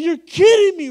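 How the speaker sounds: high, loud laughing disbelief. Retold or imitated. Preacher to the lady with the gift, laughing disbelief. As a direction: laugh it out, can't believe it. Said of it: "I said".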